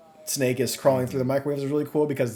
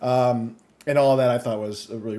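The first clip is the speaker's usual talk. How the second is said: normal talking voice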